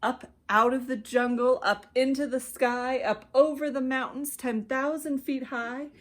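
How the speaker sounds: ordinary delivery, nothing marked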